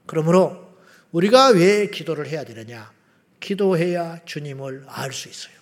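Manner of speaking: ordinary delivery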